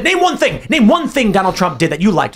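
high-pitched indignant challenge; mock sputtering outrage. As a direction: imitate a worked-up heckler demanding an answer. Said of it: "kept muttering and sputtering".

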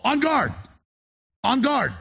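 on guard cried out high and urgent, each word hit hard; urgent warning cry. Real, not acted. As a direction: call them to arms, high and urgent. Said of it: "And I'm telling you all".

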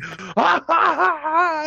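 high, loud, staccato fake ha ha; retold burst of loud obnoxious laughter; mock obnoxious laugh. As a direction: ham up a big obnoxious guffaw. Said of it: "like, really loud, obnoxious laughter".

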